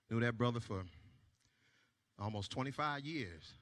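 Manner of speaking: low, soft, sombre voice, hammed-up grief; hushed, mock-sad confession to the crowd; mock somber confession. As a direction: drop the voice low like bad news.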